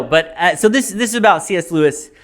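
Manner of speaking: ordinary delivery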